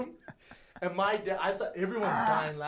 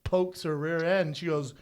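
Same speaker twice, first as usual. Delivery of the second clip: ordinary delivery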